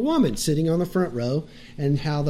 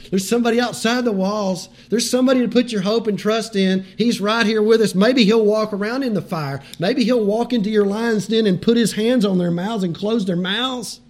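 higher, louder preaching climax; impassioned preaching; 5 semitones higher, 4 dB louder than usual. Acted. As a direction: lift the congregation with rising fervor. Preaching at full force.

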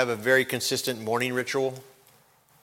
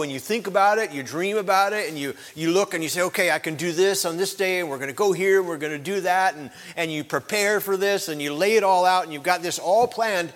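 rising preaching; 5 semitones higher than usual. Real, not acted. Pastor stirring his congregation to prepare for heaven, fervent preaching. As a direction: lift the congregation toward heaven.

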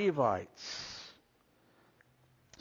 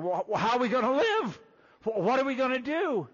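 higher, fast anxious questions; worried wife; 7 semitones higher, faster than usual. Retold or imitated. Preacher voicing a worried wife, anxious and quick. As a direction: fret aloud as a panicked wife. Wife worrying about how to live.

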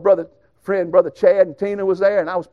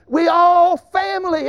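preaching emphasis; 9 semitones higher, slower than usual. Acted. Preaching climax to congregation.

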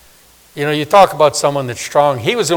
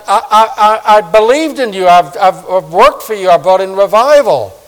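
stammering, louder, higher plea; fervent plea; 6 semitones higher, 6 dB louder than usual. Retold or imitated. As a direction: insist to God through tears. Hezekiah pleading with God.

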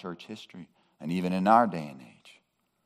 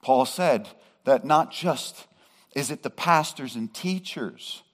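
louder and higher, driving each phrase home; preaching at full force; 8 semitones higher, 8 dB louder than usual. Acted. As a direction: preach it out to the congregation. Preacher raising voice on Paul.

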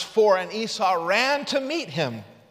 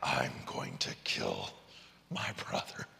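menacing threat; 12 dB quieter than usual. Acted. Esau's death threat, voiced for effect.

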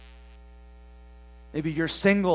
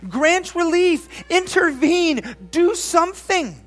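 high, loud, begging for relief; desperate plea; 10 semitones higher, 5 dB louder than usual. Acted. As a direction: cry out for God to act. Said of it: "as if the psalmist is saying".

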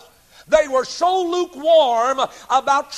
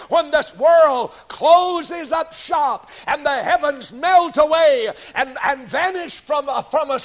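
preaching shout; 4 semitones higher, 4 dB louder than usual. Real, not acted. Preacher climax on world ending.

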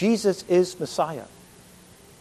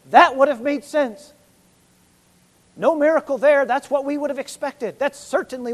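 emphatic; 6 semitones higher, 6 dB louder than usual. Real, not acted. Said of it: "emphatic preaching".

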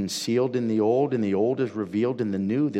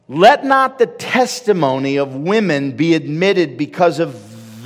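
loud, high preaching at full force; a sermon shouted with conviction; fiery preaching; 7 semitones higher, 11 dB louder than usual. Acted. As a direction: drive it home to the congregation.

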